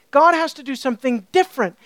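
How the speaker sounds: ordinary delivery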